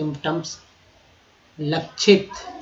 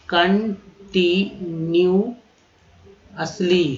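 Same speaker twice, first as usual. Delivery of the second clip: very slow, held syllables; slow demo; 3 semitones higher, 4 dB louder, much slower than usual. Shown as a demonstration. Teacher to students, very slow stretched word. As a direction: spell it out with the voice. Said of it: "teacher stretches word".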